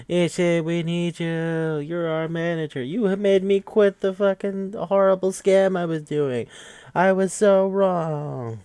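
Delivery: high, hammed-up begging; mock pleading. The guys begging their manager back, high mock plea. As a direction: overplay the desperate plea.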